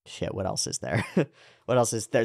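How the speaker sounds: usual speaking voice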